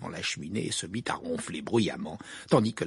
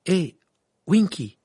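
higher, louder call to get attention; 11 semitones higher, 12 dB louder, slower than usual. A narrator's reading. Harry hails Winky on a sudden inspiration, calling out. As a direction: hail someone with sudden eagerness.

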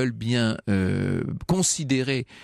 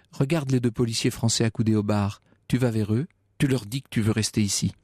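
ordinary delivery, nothing marked

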